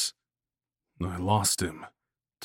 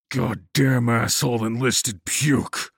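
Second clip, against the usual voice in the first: loud angry snarl, pitched up; 4 semitones higher, 5 dB louder than usual. A narrator's reading. Attacker to the downed narrator, snarling. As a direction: snarl it out as a furious insult. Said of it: "he snarled".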